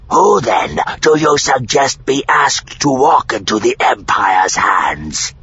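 loud, high, rough creature voice; forceful, rasping challenge; challenging alien. A narrator's reading. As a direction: demand an answer in a harsh alien voice. Alien challenges the envoy's plan.

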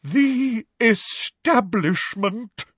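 slow, drawn-out theatrical pronouncement; dramatic, wavering. A narrator's reading. Professor Trelawney naming who insults her, dramatic and wavering. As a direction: stretch each syllable with wavering drama. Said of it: "in a deep, dramatic, wavering voice".

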